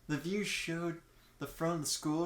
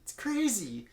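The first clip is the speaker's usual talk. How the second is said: put-on high, loud, gushing new-girl voice, much higher; gushing schoolgirl Asuka; 10 semitones higher, 8 dB louder than usual. New girl gushes to Kakyoin about school, high and loud. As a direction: overdo the sweet delight, loud and high.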